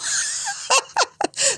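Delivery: high soft burst of laughter; laughing. Real, not acted. Laughing at the missing meat, high laugh. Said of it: "So I start laughing".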